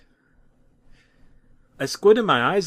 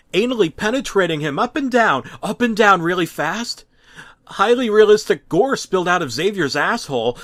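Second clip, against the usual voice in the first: frantic; 7 semitones higher, 6 dB louder than usual. A narrator's reading. Horrified frenzied retelling.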